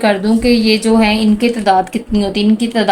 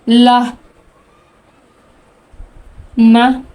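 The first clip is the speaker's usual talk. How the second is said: loud letter drill; much slower than usual. Shown as a demonstration. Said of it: "इस तरह से आपने इनकी प्रैक्टिस करनी है".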